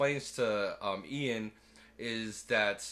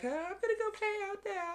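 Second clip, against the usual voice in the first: much higher, imitating a little girl; kid voice. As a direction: pipe it up like an eager child.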